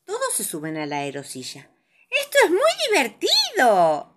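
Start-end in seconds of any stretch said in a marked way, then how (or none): 2.1-4.1 exclamación aguda y entusiasta; exclamación divertida. A narrator's reading. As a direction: exclama con voz aguda de cuento infantil. (exclamación alegre, muy aguda)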